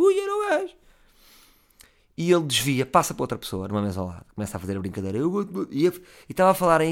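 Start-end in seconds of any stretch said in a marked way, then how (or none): 0.0-0.7 sudden shrill high-pitched yelp of alarm; a falsetto gulp of fright; startled squeak. Retold or imitated. Inner panic as the drunk lunges, squeaked high.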